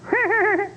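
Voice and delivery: duck laugh. Retold or imitated. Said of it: "he'll laugh, kind of laugh at you".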